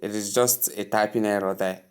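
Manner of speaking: ordinary talk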